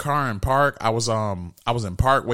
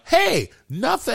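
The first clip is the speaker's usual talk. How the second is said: innocent reply; 10 semitones higher, slower than usual. Retold or imitated. Innocent reply to police, retold.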